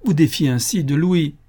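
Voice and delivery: tearful. A narrator's reading. Mariette defending Louis to her godmother, tearful. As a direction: protest as if near tears. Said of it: "ne pouvant retenir ses larmes".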